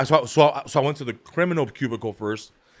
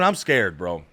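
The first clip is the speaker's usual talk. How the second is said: ordinary talk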